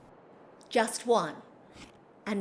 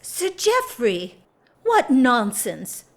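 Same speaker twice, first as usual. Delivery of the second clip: louder and slowed, a startled exclamation; surprised protest; 5 semitones higher, 5 dB louder than usual. Acted. Hostess protesting to her guest about his departure, surprised exclamation. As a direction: cry out in dismay at the news.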